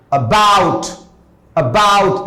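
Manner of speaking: barely touch the a, then lean hard on bout; teacher demo, about stressed on the second syllable. Shown as a demonstration. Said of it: "हम अ पे धीमे से जोर दे रहे हैं और बी पे ज्यादा जोर दे रहे हैं".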